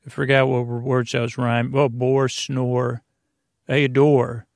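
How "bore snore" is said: usual speaking voice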